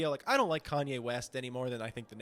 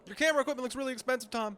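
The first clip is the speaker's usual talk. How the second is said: much higher, fast fake-admiring; mock flattery; 9 semitones higher, faster than usual. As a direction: gush sarcastically at him. Mocking paparazzi bit.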